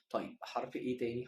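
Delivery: normal talking voice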